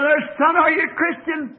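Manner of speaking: put-on preacher calls out to the injured boy, hollering; hollered question. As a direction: shout across the wreck to the boy. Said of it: "I hollered".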